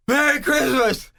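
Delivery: slow, breathless greeting after a heavy breath; winded slogging. Acted. Walker slogging up the first hill, acting exhausted. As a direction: puff it out as if worn out by a climb. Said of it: "I'm slogging up there".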